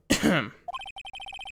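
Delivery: high, exaggerated throat clearing. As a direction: clear your throat with a showy squeak. Detective clearing his throat pompously.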